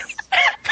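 high squeal of laughter. Real, not acted. Laughing at the hair theory.

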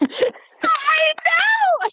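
excited shout. Real, not acted. Excited agreement, laughing.

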